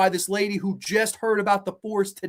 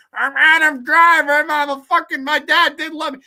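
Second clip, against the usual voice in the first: much higher, louder whiny mock voice; mock Adam Driver; 8 semitones higher, 7 dB louder than usual. Mocking Adam Driver as a whiny actor, in a high loud voice. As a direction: whine like a sulky man-child. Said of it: "Adam Driver's ginormous fucking nose".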